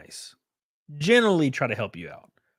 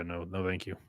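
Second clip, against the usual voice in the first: ordinary delivery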